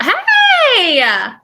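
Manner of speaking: high, loud, drawn-out hey; excited greeting. Real, not acted. Host greeting her guest as she joins, excited. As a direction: squeal hello as the guest pops on.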